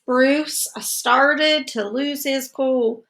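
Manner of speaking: usual speaking voice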